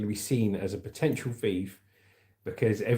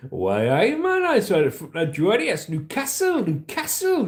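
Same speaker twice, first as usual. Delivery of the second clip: high, exaggerated Newcastle accent; Geordie accent; 8 semitones higher, 4 dB louder than usual. Shown as a demonstration. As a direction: ham up a high-pitched Geordie voice. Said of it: "And Newcastle".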